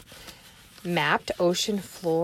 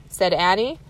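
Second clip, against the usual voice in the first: ordinary delivery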